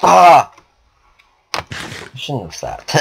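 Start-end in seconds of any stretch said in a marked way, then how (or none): 0.0-0.5 short, loud startled cry; startled yelp. Real, not acted. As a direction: yelp in sudden shock. Sudden death in the game.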